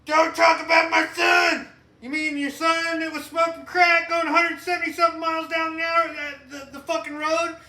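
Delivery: high, loud, furious rant; mock angry president. Imitating the president going off on people, shouting. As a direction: bellow it defending a son. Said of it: "He's like".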